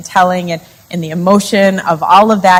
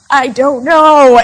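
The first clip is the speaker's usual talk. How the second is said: louder, high, drawn-out protest; exasperated retort; 5 semitones higher, 5 dB louder, slower than usual. Retold or imitated. Wife retelling her answer to her pressing husband, exasperated. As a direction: throw it out slowly, high and exasperated.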